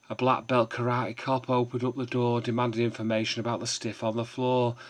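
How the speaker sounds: loud, punchy performance of a comic poem; full-voiced performance reading, hitting the rhymes; poem recital. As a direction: declaim it like a pub stage poet.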